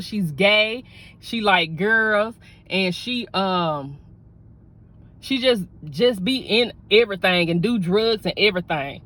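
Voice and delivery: ordinary talk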